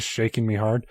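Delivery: plain reading voice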